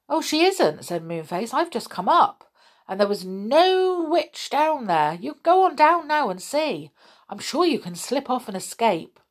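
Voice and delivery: high, cheerful reassuring voice; Moonface's high voice. A narrator's reading. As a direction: reassure brightly.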